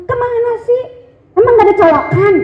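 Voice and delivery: high, shrill-pitched scolding on the phone; wife yelling on phone. Acted. Wife scolds late husband on the phone, yelling. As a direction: snap at him down the line. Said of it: "teriak semuanya".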